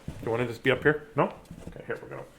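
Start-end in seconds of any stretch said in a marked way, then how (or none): 1.7-2.2 soft breathy whisper to the cat. Real, not acted. Settling her cat on her lap, whispering. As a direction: hush it so the cat stays calm.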